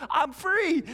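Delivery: high, loud cry of pure joy; freed man yelling to a guy at the water cooler, elated; ecstatic shout. Acted. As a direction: yell it at a stranger with delight. Greeting stranger at water cooler.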